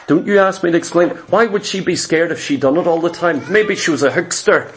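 usual speaking voice

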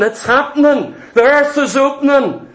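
high-pitched acted alarm; scared to death. Witch when the summoning really works, acted fright. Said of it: "She's scared to death".

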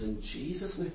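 imitating a preacher's hushed, low command; very quiet. Evangelist praying from the pulpit over the noise, very quiet. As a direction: drop right down, low and barely audible. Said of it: "he said very quietly".

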